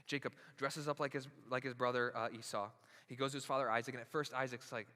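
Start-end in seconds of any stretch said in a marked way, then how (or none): none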